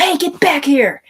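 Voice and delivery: high, loud, slow command from the cop; a policeman shouting after someone running away; cop calling out. Retold or imitated. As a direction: call out "get back here", stretched and loud. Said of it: "He's like".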